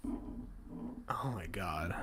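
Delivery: slow soft whisper; hushed disbelief. Real, not acted. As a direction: breathe it out slowly.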